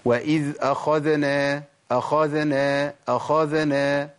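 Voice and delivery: أخذنا slow with a vowel slipped after the ذ; vowelled dhal error. Shown as a demonstration. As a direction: let a short vowel creep in after the ذ in أخذنا. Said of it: "تحريك الذال".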